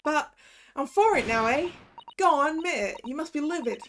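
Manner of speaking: lightly put-on Cockney accent, confessing; a street girl's Cockney, owning up; Gina's Cockney. Gina confessing her false testimony, light Cockney accent. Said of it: "you think the Cockney is better".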